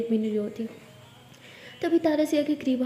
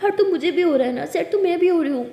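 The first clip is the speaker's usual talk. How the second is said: higher, louder hurt outburst; upset, pitched-up pleading; acted crying protest; 5 semitones higher, 5 dB louder than usual. A narrator's reading. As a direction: wail the complaint at your partner. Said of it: "रो क्यों रहे हो?".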